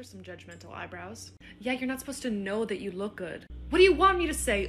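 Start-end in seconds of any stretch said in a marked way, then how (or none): 3.7-4.7 loud, exasperated demand; louder, high exasperation; exasperated outburst. Acted. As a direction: throw the question back, fed up. Arguing about eyebrow talk.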